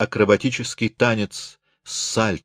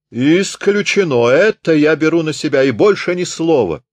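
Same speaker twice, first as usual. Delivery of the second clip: loud insistence; 7 semitones higher, 5 dB louder than usual. Acted. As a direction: overrule them loudly. Riesenfeld insists on paying.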